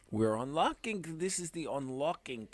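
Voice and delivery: put-on light Yiddish accent, sing-song. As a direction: say it with a Yiddish lilt. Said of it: "we're saying this with a Yiddish accent".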